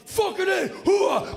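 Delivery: very high, loud military whoop; soldiers cheering. Retold or imitated. Soldiers cheering the signed bomb. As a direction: bellow it like cheering troops. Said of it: "They loved it".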